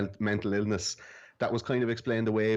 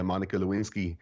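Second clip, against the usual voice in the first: normal talking voice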